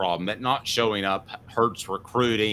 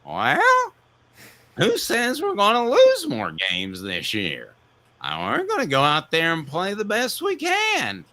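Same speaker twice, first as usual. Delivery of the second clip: much higher, loose tipsy coach voice with a tearful wobble; Jerry Green voice; 8 semitones higher than usual. Acted. Host as Jerry Green answering a fan's DeBoer question, high and tipsy. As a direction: play a slurring, half-drunk coach answering a fan. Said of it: "So in my Jerry Green voice".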